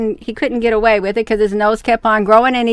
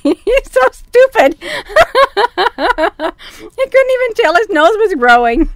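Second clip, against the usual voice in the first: much higher, laughing through the words; 10 semitones higher than usual. Real, not acted. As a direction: squeal it out while cracking up. Reader comments, laughing hard.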